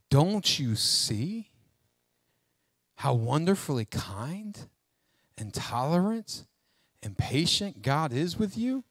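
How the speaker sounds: slow, soft, tender questioning with long pauses; gentle appeal. Acted. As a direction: let the kindness sink in, softly. Pleading after the railing stops.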